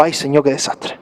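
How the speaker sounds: a groaning complaint over the news; weary lament. Retold or imitated. Reading the newspaper, he sighs a soft, low lament. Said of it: "y digo".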